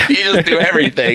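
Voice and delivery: high voice through a laugh; giggling put-on wife voice; mock wife, laughing. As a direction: laugh while parodying her.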